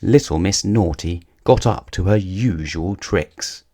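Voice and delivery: ordinary delivery, nothing marked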